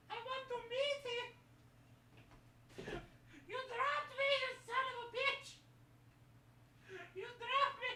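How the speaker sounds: high soft squeaky voice; squeaky high character voice; high puppet voice. Acted.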